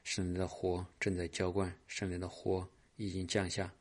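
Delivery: soft, murmured charismatic prayer; a pastor praying softly over the congregation; hushed prayer. Acted. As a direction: pray quietly, welcoming the Spirit. Leads a soft Spirit prayer.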